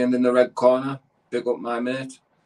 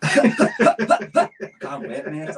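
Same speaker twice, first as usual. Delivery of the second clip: laughing through excited words; 13 semitones higher, 4 dB louder, much slower than usual. Real, not acted. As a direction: laugh while you say it. Giddy pre-fight excitement.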